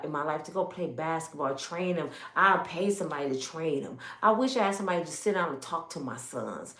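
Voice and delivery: soft, low longing plea; a single mother wishing for a good man; imitated caller. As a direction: plead quietly for help.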